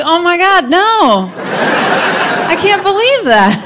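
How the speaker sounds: high, loud, gushing disbelief; hammed-up astonishment. Comic replying to friend's unbelievable email, hammed-up astonishment. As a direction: exclaim it in exaggerated shock, high and stretched.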